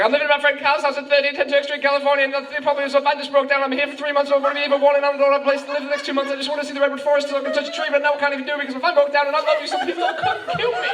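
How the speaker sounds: high, fast, breathless word flood; panicked babbling. Acted. Tourist telling the policeman everything, panicked babbling. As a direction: blurt everything out in hammed-up terror, never stopping. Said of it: "all these words just kept coming out my mouth".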